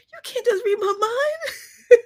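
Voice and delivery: high, slow laughing complaint; retelling a plea, laughing high. Retold or imitated. Retold plea to someone.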